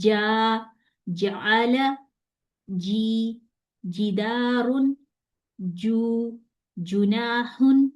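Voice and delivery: slow, drawn-out model syllables on a level pitch; careful tajweed demonstration; jeem recitation drill. Shown as a demonstration. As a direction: recite "جا، جعل" with held vowels and voiced j. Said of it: "یہ آپ نے جیم کی sound نکالی".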